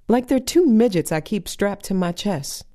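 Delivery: normal talking voice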